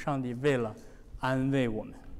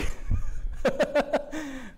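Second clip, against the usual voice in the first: laugh; 10 semitones higher than usual. Acted. Moses doubting himself, laughs.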